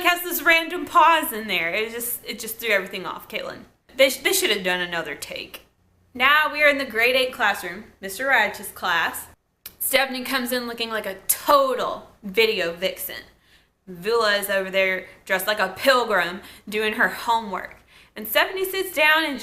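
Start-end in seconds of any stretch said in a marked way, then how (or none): none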